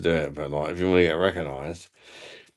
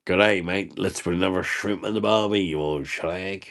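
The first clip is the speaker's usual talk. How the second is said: lightly put-on Australian accent, teasing; mock Australian accent. Mocking a viewer who called him Australian, in a put-on Australian accent. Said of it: "I'm not from Aussie".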